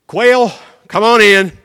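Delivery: loud slow summons; booming slow call. Acted. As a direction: call the quail in from afar. God summons quail.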